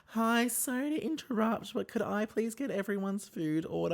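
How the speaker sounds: high polite waiter interrupting; timidly butting in to take orders. Retold or imitated. Waiter interrupting the yelling table, politely high. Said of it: "And she's like".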